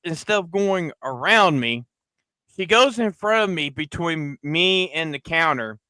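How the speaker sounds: ordinary talk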